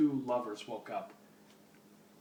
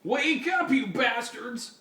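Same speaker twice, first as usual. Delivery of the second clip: higher, slower, louder wake-up yell; shouted; 9 semitones higher, 5 dB louder, slower than usual. Acted. Old hippie slaps the captured heroes awake, shouting. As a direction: bark them awake. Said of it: "shouted the old hippie".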